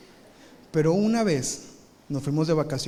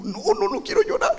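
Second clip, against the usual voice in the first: put-on high, choked kid's voice; holding back tears. Hurt kid insisting he won't cry, high and strained.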